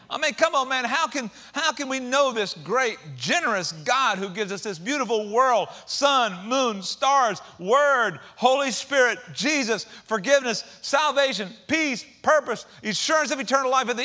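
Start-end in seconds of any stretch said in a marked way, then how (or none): none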